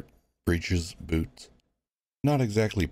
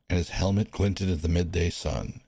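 plain reading voice

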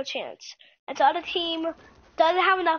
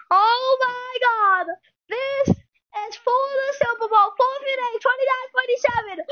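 higher, excited sportscaster calling the drive; play-by-play call; 7 semitones higher than usual. Acted. Gamer calling his own game for viewers, excited announcer voice.

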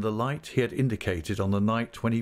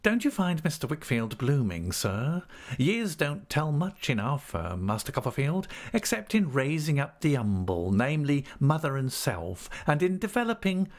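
higher-pitched obsequious clerk; fawning Uriah Heep; 6 semitones higher than usual. Acted. Clerk flatters his firm to Copperfield, fawning. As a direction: praise the firm with oily humility.

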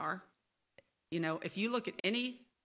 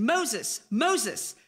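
calling out; 5 semitones higher, 5 dB louder than usual. Acted. God calls from the bush.

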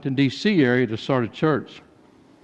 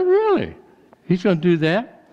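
sharply higher, incredulous "Really?"; mock surprise; 4 semitones higher than usual. Pastor mock-stunned at the rival vendor's threat. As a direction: play up the disbelief, half amused.

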